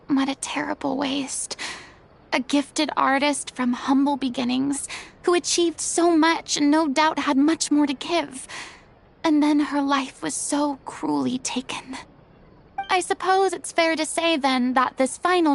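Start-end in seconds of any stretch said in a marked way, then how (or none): 0.1-1.6 hushed, mournful regret; sorrowful whisper. Acted. Furina mourns the troupe's director in a whisper. 9.2-12.0 hushed, mournful regret; sorrowful whisper. Acted. Furina mourns the troupe's director in a whisper.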